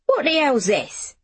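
high opening, falling indignant demand; narrated flash of anger; outraged. A narrator's reading. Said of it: "Her expression turned to outrage".

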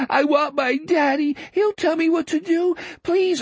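put-on high-pitched child pleading for his daddy; distraught boy dreaming. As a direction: plead like a lost little boy. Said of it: "Richard was muttering in his sleep".

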